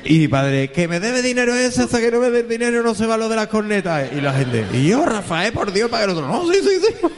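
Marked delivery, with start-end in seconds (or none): 0.7-4.1 much higher, insistent creditor voice; imitating the father. Father telling the neighbours the band stays until he is paid, mock insistent. 4.7-7.1 high pleading, breaking into laughs; mock pleading neighbour. Neighbour begging Rafael to stop the band, mock pleading.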